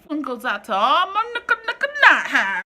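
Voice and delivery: loud, high character voice; loud, high sitcom impression; Martin character catchphrase. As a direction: belt it like a Martin sketch.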